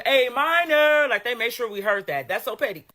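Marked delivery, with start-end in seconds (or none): 0.0-1.1 drawn-out high, loud A minor chant; mock crowd scream. Imitating the audience screaming at the halftime show, drawn out and loud. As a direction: belt it like a stadium crowd. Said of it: "did you hear the whole audience scream".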